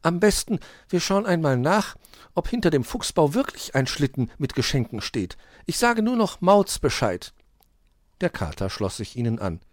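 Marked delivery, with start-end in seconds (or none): 0.0-7.3 high, lively character voice; Hoppel, sensible friend. A narrator's reading. Hoppel suggests checking behind the fox den, decisive. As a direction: propose a plan in a raised voice.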